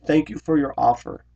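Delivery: high, polite brush-off; diplomatic, tactful. Retold or imitated. Friend tactfully turning down an offer, imitated polite tone. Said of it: "trying to be diplomatic and tactful".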